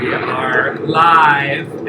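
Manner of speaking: dramatic announcer. Acted. Dramatic show opening.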